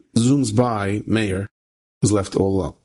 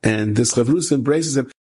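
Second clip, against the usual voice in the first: usual speaking voice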